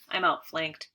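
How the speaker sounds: ordinary delivery, nothing marked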